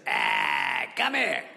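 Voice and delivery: shouted, raspy dog voice; demanding dog. Retold or imitated. Scooby calling the visitor over, demanding shout. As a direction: holler lazily for company as the dog. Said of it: "Scooby's like this".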